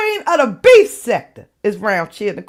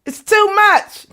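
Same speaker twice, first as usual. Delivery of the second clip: too much; 10 semitones higher, 4 dB louder, slower than usual. Real, not acted. Overwhelmed laughter.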